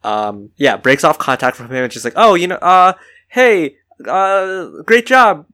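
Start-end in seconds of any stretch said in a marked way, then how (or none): 2.2-5.5 high, loud flustered babble; mock awkward congrats. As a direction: stumble through forced praise. Eliza awkwardly congratulates Henry, flustered.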